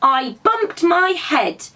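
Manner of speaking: grumpy small rabbit complaining; cross little voice again. A narrator's reading. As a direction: rabbit repeating herself crossly. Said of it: "answered the cross voice again".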